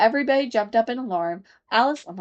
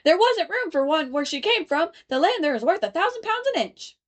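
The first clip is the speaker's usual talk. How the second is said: higher sing-song chorus; chorus of voices; 9 semitones higher than usual. A narrator's reading. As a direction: chant it as a crowd of passengers. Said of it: "the chorus of voices went on".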